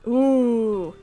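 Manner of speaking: ordinary talk, nothing marked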